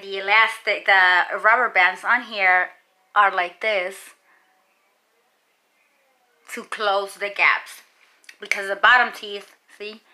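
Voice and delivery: speaking with lip pulled; finger pulling lip down. Real, not acted. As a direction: finger in mouth. Seen 1: Finger pulls lower lip down to show braces, pausing around 7.7-10.2s.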